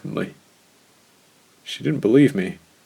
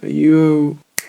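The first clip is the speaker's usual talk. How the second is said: calling out; 7 semitones higher, 9 dB louder than usual. A narrator's reading. Calling down, cut off.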